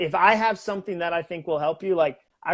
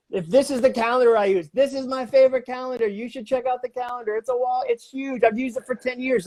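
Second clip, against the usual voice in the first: hyped plug; 7 semitones higher than usual. Retold or imitated.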